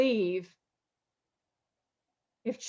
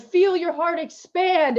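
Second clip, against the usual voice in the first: emotional; 7 semitones higher, 4 dB louder than usual. Real, not acted. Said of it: "that's why I'm emotional".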